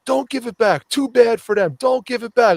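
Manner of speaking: mock commenters. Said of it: "everyone was like".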